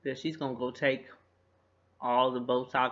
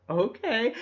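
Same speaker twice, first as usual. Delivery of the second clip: mock scared compliance; 9 semitones higher, 5 dB louder than usual. Frightened couple obeying the robber.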